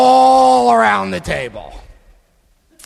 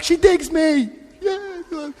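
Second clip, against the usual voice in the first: cocky and much higher, "She digs me" tossed off smugly; arrogant American; 12 semitones higher, much slower than usual. Retold or imitated. Retelling his airport boast, cocky and higher. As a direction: play the overconfident pilot sure she's into him. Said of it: "being the arrogant American".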